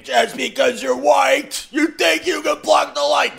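high shouted rant; screaming. Retold or imitated. The driver screams from his window, high shouted rant. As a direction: yell it like a madman. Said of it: "he's screaming".

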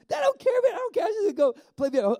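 a thrilled fan brushing off the mess, high and loud; excited insisting. Pretend. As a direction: beg to come over, squealing. Eager fan insisting in story.

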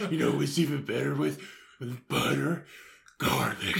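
lightly voiced gluttonous superhero; fat Batman. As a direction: relish food as a brooding hero. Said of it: "You're fat Batman".

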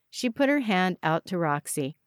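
ordinary delivery

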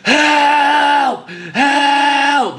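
imitated high, drawn-out cry for help; dying last-breath scream. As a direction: wail it like someone on their last breath. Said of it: "sounded like someone dying on their last breath".

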